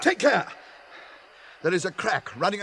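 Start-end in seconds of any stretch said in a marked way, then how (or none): 0.0-0.5 sharp, high, loud warning; warning shout. Acted. Gandalf warning the hobbits of the crack, shouting. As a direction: shout a quick warning ahead.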